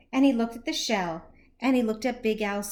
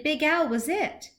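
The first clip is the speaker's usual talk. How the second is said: ordinary delivery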